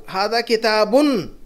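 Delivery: slow "किताबुन" with a held final "un"; stressed ending. Shown as a demonstration. Teacher showing the Arabic indefinite, drawing out "un" in "किताबुन". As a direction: stretch the "un" closing "किताबुन". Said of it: "उसी की जगह उन अन इन आ रहा है".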